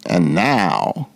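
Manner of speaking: low, sly Grinch voice, lightly done; a hint of a scheming Grinch; grinning Grinch. Grinch savoring his theft, low and sly. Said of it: "grinned the Grinch".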